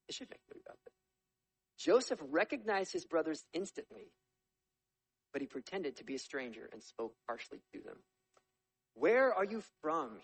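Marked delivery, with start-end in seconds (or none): 0.1-0.9 breathy whisper; whispered aside. Real, not acted. Whispering to the class an aside. 8.9-10.2 loud, slow, higher demand. A narrator's reading. Governor Joseph demanding of his brothers, loud. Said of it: "he demanded".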